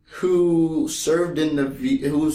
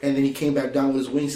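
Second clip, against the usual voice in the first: ordinary talk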